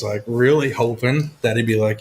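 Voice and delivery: ordinary talk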